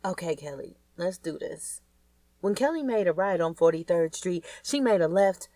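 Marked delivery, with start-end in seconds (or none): 0.1-1.8 talking to herself. Acted. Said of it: "talking to herself".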